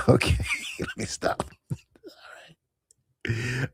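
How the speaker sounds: laughing under the breath; breathless laugh. Real, not acted. Host tells herself to stop, breathless laughing. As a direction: wheeze and try to stop laughing.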